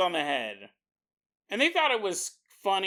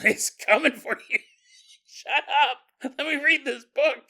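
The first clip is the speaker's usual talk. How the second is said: laughing mock annoyance; 9 semitones higher, 6 dB louder than usual. Imagined annoyed reader snapping back.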